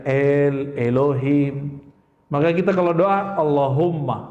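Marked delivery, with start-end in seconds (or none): 0.0-1.8 Elohim drawn out slowly and low, almost sung; Hebrew pronunciation. Shown as a demonstration. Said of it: "dengan dialek yang beda".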